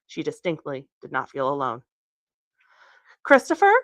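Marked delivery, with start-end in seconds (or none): 3.2-3.7 much higher, louder, scared call into the trees; high frightened call. A narrator's reading. Kathleen alone in the woods calls for Christopher, high frightened cry. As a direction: call out his name in rising fear. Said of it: "Her voice was unrecognizable, high and shrill".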